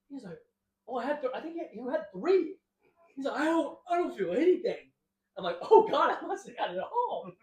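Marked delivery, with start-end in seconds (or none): 0.9-4.9 raised, louder voice for Caleb; loud high voice of the friend; friend's voice. Retold or imitated. 5.6-7.3 high, loud panicked exclamation; high loud mock panic; mock alarm. Reacting to being too high.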